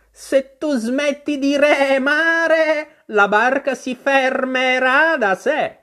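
high, slow, bleating-long vowels, loud; an exasperated sheep stretching out every stressed vowel; scolding sheep. Acted. The sheep scolds Alice for spinning round, high drawn-out scolding.

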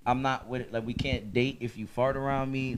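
low, quiet, lightly voiced offended woman; a hint of a prissy girlfriend; mock woman's complaint. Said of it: "a female said".

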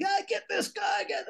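high alarmed yell at an intruder; guards yelling. Retold or imitated. Guards coming out with guns shout at driver, high alarmed yell. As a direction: bark the order to grab him.

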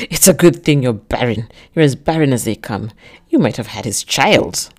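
loud, venomous sneer; a bitter man spitting cruelty at her; spiteful. A narrator's reading. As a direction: spew it out with spite. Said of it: "spew out with so much spite".

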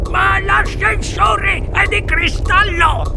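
high, stretched, frightened protest; stutter, then wail the objection up high; aghast grandfather in high-pitched terror; panicked stammer. A narrator's reading.

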